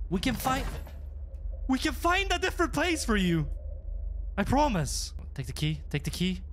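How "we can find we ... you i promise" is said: high-pitched placating promise; anxious, high appeasing tone; pleading reassurance. Pretend. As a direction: talk them down, voice pitched high. Placating the ghosts to escape.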